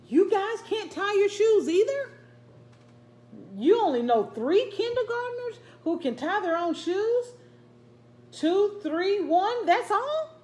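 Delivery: put-on high, loud, amazed little girl; amazed kid voice. Little girl asking classmates about shoes, high amazed. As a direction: voice a kindergartner, astonished.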